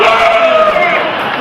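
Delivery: high, loud scream of terror; terrified scream. Retold or imitated. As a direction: scream as if hung out of a speeding train window. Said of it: "And I'm like".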